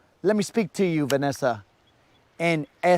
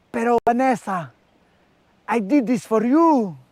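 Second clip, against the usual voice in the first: higher mock telenovela pleading; dramatic plea; 7 semitones higher than usual. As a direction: overplay the wounded soap-opera lover. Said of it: "And you're like".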